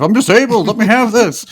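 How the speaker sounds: high, loud hammed-up excuse; mock entitled excuse. Host jokes about kissing a wife, loud mock excuse. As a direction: beg it like a spoiled prankster. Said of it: "just started making out".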